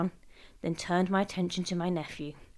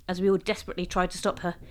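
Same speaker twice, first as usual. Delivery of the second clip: ordinary delivery, nothing marked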